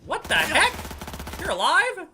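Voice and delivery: high, very loud disbelief; startled yell. Real, not acted. Player shocked that the enemy is alive, startled high yell. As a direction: burst out in shocked questions.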